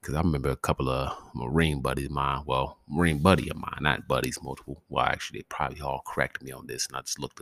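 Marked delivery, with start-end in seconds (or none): none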